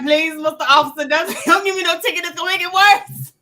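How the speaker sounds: high, loud begging, half laughing; mock pleading. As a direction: plead your way out of a ticket, playfully hammed-up. Retold begging to avoid a ticket.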